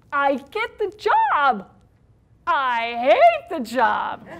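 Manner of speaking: big comic emphasis; drawn-out punchline. Real, not acted. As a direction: slam both lines home. Punchline about job.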